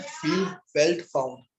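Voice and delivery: drawn out, word by word, slow; slow dictation, each word stretched; slow recitation. Shown as a demonstration. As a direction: spell out फील, felt, found slowly for students to copy. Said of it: "पहला point again क्या करना है".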